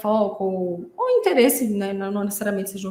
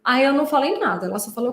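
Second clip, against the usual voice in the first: ordinary talk, nothing marked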